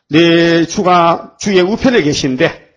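very slow, deliberate recitation; psalm recitation. Acted. Preacher quoting the psalm to the church, very slow. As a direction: linger on each word.

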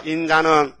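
구절 따라 외치기. Shown as a demonstration. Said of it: "따라합시다".

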